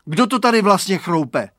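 high, loud angry question; exasperated demand. A narrator's reading. Boy demanding who is crunching, exasperated. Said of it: "rozčílil se Radovan".

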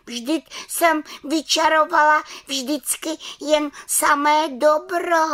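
very high-pitched offended little witch; hurt protest. A narrator's reading. Little witch defends her good magic, sounding hurt. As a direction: protest high, stretching the last words. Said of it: "dotčeně se zeptala".

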